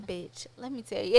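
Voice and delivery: hushed, laid-back gossip opener; so calm. Retold or imitated. Calling a friend with news, calm and hushed. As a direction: keep it low and relaxed. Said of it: "I literally would call her so calm".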